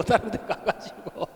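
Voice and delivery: ordinary delivery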